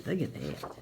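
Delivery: ordinary talk, nothing marked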